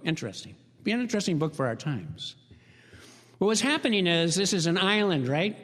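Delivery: normal talking voice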